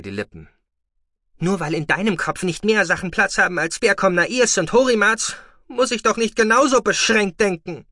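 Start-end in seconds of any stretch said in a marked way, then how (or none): 1.4-7.9 very high, loud scornful retort; defiant shouting. Acted. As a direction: sneer back in defiance. Boy mocks foster father defiantly.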